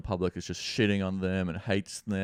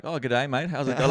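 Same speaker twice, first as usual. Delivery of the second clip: louder, higher, playful Australian greeting; hammed-up Aussie slang; 5 semitones higher, 6 dB louder than usual. Shown as a demonstration. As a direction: ham up a matey Aussie hello. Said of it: "throwing a bit of Australian slang".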